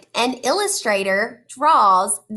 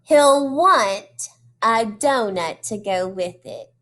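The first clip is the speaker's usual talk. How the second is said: put-on reading to children; 2 semitones higher than usual. Teacher reading a picture book to her class, animated storytelling. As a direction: draw the kids into each page.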